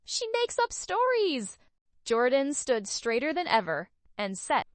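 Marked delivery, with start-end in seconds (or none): 0.0-1.6 high, loud frantic yell. A narrator's reading. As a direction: drown out her daughter. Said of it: "Carly yelled".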